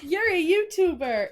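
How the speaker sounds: put-on high, slow singsong kid voice; child voice. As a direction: tease it like a little kid. Mimicking the niece's voice note.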